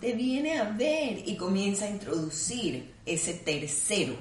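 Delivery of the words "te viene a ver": put-on high, slow coo to the baby; mother's baby voice. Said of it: "la mamá va a decir". (mamá al bebé, con voz aguda y lenta)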